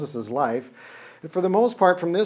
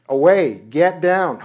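loud, slow order; commanding shout; 2 semitones higher, 8 dB louder, slower than usual. Acted. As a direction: bark it down the mountain like a command. God ordering Moses down.

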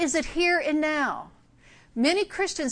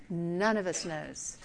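"None of us knows" lower, softer and slowed; quiet repeat; 7 semitones lower, 7 dB quieter, slower than usual. Shown as a demonstration. Preacher driving the point home, soft slow echo. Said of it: "but none of us knows".

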